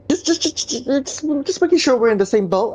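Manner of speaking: high-pitched, stuttering backpedal; stammering hedge. Retold or imitated. Voices Griffin nervously drawing the line.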